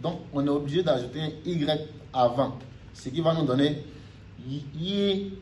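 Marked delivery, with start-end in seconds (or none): 4.5-5.3 yi held high and level, first tone. Shown as a demonstration. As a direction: stretch yi out on a high flat pitch. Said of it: "vous voyez, yi".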